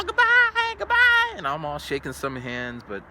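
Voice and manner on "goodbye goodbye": high, loud singsong goodbye; high loud Japanese-accented goodbye; students saying goodbye. Retold or imitated. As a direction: mimic kids calling out, high. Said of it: "they're all".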